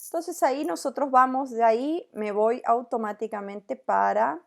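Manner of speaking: normal talking voice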